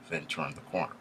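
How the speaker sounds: usual speaking voice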